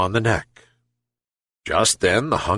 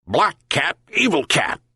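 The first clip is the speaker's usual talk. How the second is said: yelped; 7 semitones higher than usual. Acted. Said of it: "yelped the dog".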